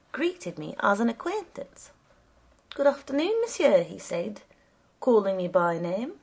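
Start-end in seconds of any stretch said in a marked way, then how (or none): 2.7-3.8 higher, louder polite greeting; courteous commissaire, lightly voiced; official's greeting. A narrator's reading. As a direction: greet an acquaintance formally.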